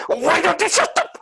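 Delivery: half-laughing mock yell at the noisy person; imitated shout. Positive lady turning on a noisy woman, imitated shouting. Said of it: "she started shouting".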